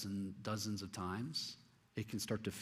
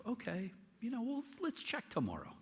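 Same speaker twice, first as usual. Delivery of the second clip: much higher, softer doubting tone; skeptical reply; 14 semitones higher, 4 dB quieter than usual. Retold or imitated. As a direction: humour her, pitch way up and unsure. Doubtful reply to healed friend.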